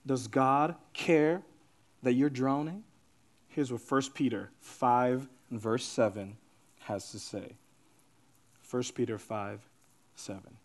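low, soft, measured preaching with long pauses; hushed scripture delivery. Real, not acted. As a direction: lay the verse down gently, phrase by phrase. Preacher slows to deliver the verse.

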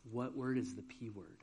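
put-on low, soft question to a small child; hushed coaxing. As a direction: imitate a teacher quietly probing. Teacher gently coaxing scared child.

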